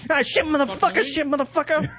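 high, hammed-up mobster swearing; Goodfellas impression. Doing a Goodfellas-style gangster swearing for the co-host. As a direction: spit out curses like a movie gangster. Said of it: "That's my Goodfellas impression".

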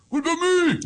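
high, loud call through the speaker. Retold or imitated. Kid in the trunk calling out to the gate guard, high and loud. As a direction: yell from the trunk to be counted.

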